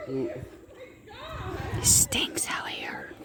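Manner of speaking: hushed, breathy whisper out in the swamp. Real, not acted. Vlogger whispering to viewers on a stinky swamp walk. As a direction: drop to a whisper without knowing why. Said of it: "Why am I whispering?".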